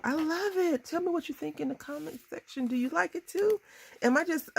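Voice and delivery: high-pitched, delighted gush; thrilled and proud, voice lifted high; gushing excitement. Real, not acted. Gushing over her finished card.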